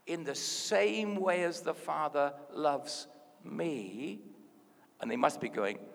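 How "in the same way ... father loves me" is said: drawn-out scripture line; slow, deliberate delivery; slow emphatic quotation. Acted. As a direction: stretch the key words so they sink in. Jesus quoted slowly for emphasis.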